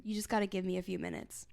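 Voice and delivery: ordinary talk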